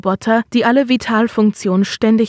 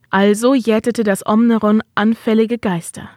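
plain reading voice

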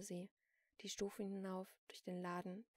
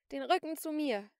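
high, loud command; shouted order; 7 semitones higher, 14 dB louder than usual. A narrator's reading. Celine makes the shopkeeper turn round, shouting. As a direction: keep yelling orders. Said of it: "schrie Celine".

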